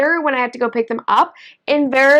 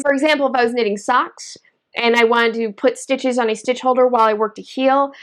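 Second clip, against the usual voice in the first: ordinary delivery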